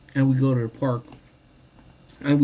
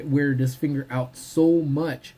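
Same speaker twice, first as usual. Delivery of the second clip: usual speaking voice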